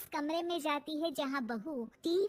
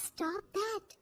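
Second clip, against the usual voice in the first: softer, high-pitched echo; recalled command; 4 semitones higher, 6 dB quieter than usual. A narrator's reading. Daughter-in-law's words echoing to frozen mother-in-law, soft high echo. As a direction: say it small and high. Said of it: "ये जो कहकर गई थी वो".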